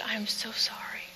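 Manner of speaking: whispering the nurse's words, barely voiced; hushed whisper. Nurse with tears whispering her condolence to the wife. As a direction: breathe it out like a nurse holding back tears. Tearful nurse breaks the news.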